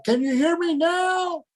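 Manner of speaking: high and slow, drawn out; calling out high. Real, not acted. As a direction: call it out slowly and high up. Mic check to remote caller.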